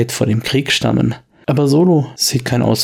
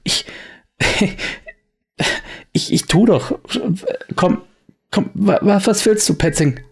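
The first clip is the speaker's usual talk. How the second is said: stotternder Wirt, deutlich höher; er fleht, stammelnd und hoch; verängstigter Wirt; 8 semitones higher than usual. Acted. Wirt wird bedroht. (verängstigter Wirt, hoch und stotternd)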